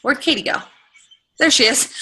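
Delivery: usual speaking voice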